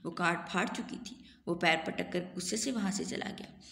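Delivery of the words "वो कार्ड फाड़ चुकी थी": usual speaking voice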